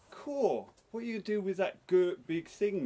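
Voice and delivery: high, loud little woman's voice. A narrator's reading. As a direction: pipe up as a cheeky little woman. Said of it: "A little high woman's voice said".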